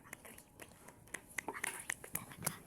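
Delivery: scared hushed whisper. Real, not acted. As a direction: whisper while hiding in the dark. Hiding, whispering it's creepy.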